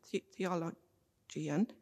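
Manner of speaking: ordinary delivery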